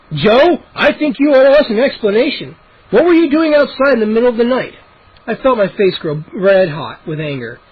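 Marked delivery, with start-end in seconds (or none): 0.1-4.7 angry. A narrator's reading. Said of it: "Dad exploded".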